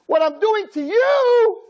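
high, slow protest; mock indignant child. Child pushing back at scolding parents, high and indignant. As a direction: protest like a wounded kid.

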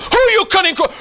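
loud, high furious yell; road rage shout. Acted. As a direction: blow up at the driver who cut you off. Raging driver acted out.

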